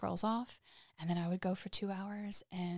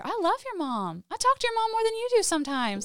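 very high, loud protest; hammed-up defensive mom; exasperated retold insistence; 13 semitones higher, 7 dB louder than usual. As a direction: plead your case in a squeaky, worked-up voice. Retold argument with the girls.

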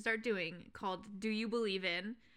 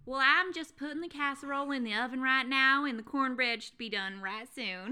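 Southern accent; 3 semitones higher, 3 dB louder than usual. Shown as a demonstration. Cohost performs casserole line on request, Southern accent. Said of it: "Say, in the Southern accent".

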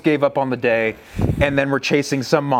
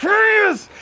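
much higher burst of triumph; excited yes; 4 dB louder than usual. Retold or imitated. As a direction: pounce on it with joy. Bull coming into range.

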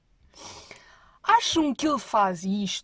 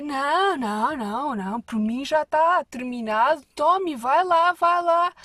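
flustered urging; 6 semitones higher, 7 dB louder than usual. Retold or imitated. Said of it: "E é tipo".

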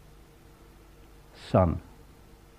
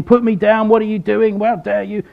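mock scream; 6 semitones higher, 3 dB louder than usual. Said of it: "This man wasn't screaming".

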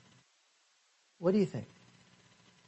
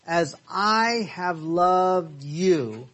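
drawn-out, measured verse reading; slow reading; 3 dB louder, much slower than usual. Shown as a demonstration. Teacher reading scripture slowly to the class. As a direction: give each word of "as I have loved you" its own weight, slowly.

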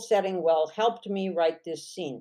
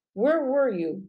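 sharp, higher demand; 6 semitones higher than usual. A narrator's reading. Vance to Fonny, worried, demanding. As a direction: Vance demands where she's been. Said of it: "demanded Vance".

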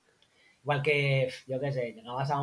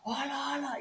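put-on shocked kids; 12 semitones higher, 5 dB quieter than usual.

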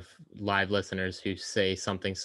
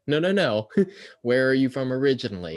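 louder, higher mock insistence with a laugh; laughing mock questioner; 4 semitones higher, 5 dB louder than usual. Host retelling a stranger's question to guests, laughing. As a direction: laugh while playing the pushy stranger.

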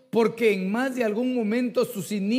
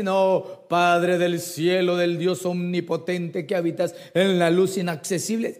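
pompous mock prayer; 4 semitones lower than usual. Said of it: "Si quieres hablar así". (oración pomposa exagerada, lenta y cantada)

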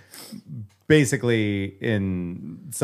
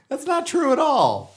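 mock high voice; 4 dB louder than usual. Mock defending Alexa.

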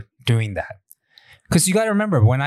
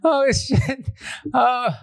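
laughing gasp; 11 semitones higher, much slower than usual. Real, not acted. Laughing after the joke.

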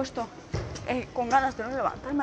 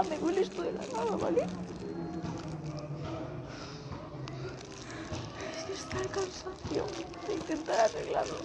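higher, softer, near crying; words catching as tears rise; voice breaking; 3 semitones higher, 9 dB quieter than usual. Real, not acted. Telling the camera about giving up, voice breaking and soft. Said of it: "menos mal que no estoy llorando, estoy aguantando".

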